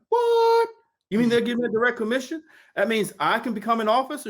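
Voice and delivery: high, fast, amazed; a sudden shout of joyful disbelief; stunned excitement. Retold or imitated. Husband to wife on the commission, high amazed rush.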